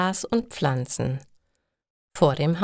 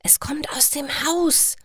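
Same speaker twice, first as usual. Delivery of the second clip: ängstliches Flüstern, hauchlos; geflüstert; 10 semitones higher than usual. Acted. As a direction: leise zuflüstern, damit es keiner hört. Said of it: "flüstert Georg". (Georg flüstert Daniel ängstlich zu)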